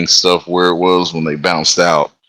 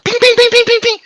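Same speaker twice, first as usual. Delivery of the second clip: high, sharp imitated ricochet pings; bullet pings; 5 dB louder, faster than usual. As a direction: mimic bullets hitting metal in a high voice. Imitating bullets hitting the train.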